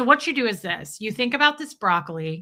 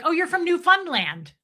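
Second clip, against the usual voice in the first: pretend recognition; 8 semitones higher than usual. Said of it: "I'm gonna be like".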